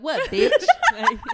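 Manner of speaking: laughing defiant challenge. Retold or imitated. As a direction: throw it out mid-laugh.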